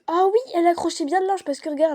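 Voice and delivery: high, loud sudden insight; light up with the idea; excited realisation. Real, not acted. Sudden realisation while explaining.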